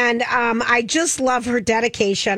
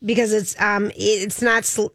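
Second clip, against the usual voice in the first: usual speaking voice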